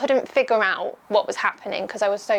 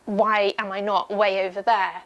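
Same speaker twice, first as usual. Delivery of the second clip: ordinary talk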